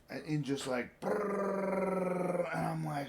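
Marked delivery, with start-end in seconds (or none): 1.0-2.5 rapid rolling brrr. Retold or imitated. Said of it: "how it just rolled one word into the next".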